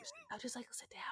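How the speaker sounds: quick hushed whisper. Pretend. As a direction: whisper it like not to wake someone. Whispering the plan.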